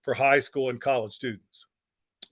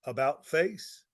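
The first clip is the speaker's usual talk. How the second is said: ordinary delivery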